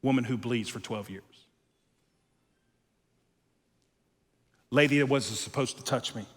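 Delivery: gently addressing a frightened woman; tender Jesus. Retold or imitated. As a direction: speak to her like a comforter.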